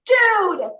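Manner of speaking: high, loud panicked "Dude"; a sharp alarmed yelp at the pilot; freaking out. Retold or imitated. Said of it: "freaking out".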